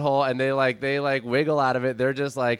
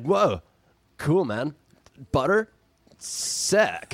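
laid-back dude with pauses between words; cool dude; much slower than usual. Acted. Puppy marvels at butter in cool dude voice. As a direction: play it as a stoked, unhurried surfer dude. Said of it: "like, like, a cool dude voice".

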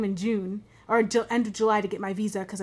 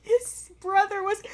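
much higher, slow, crying voice; crying explanation; 10 semitones higher than usual. Retold or imitated. Daughter sobbing to her mom about the movie, high and slow. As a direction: sob it out while trying to explain. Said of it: "I'm just like".

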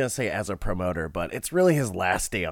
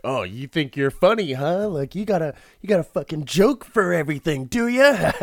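higher, louder heckling rant; mock yelling; 6 semitones higher, 6 dB louder, faster than usual. Condemned man yelling at nobody, mock-angry taunt. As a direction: build to an indignant mock yell. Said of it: "just kinda yelling to nobody".

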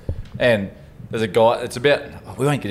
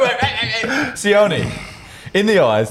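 insistent; 12 semitones higher, much slower than usual. Real, not acted. Demanding the truth from Sione.

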